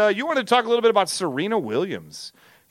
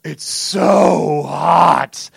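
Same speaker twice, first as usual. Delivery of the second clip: very slow, loud overacting; mock commercial actor; 2 semitones lower, 5 dB louder, much slower than usual. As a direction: stretch every word as a hammy ad line. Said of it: "looks right at her, goes".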